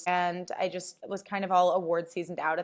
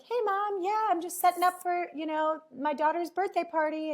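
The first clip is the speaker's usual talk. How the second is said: bright high daughter voice on the phone; high voiced daughter; 10 semitones higher than usual. Daughter telling mom about the party, high and chirpy.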